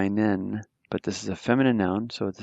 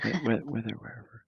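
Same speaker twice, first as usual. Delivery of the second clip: whispered aside; 8 dB quieter, faster than usual. Real, not acted. Trailing aside to himself.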